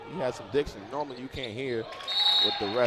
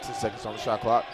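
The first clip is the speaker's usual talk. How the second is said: usual speaking voice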